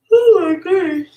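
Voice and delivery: said through a high, loud yawn. Real, not acted. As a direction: let the yawn stretch the words. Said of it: "I'm yawning".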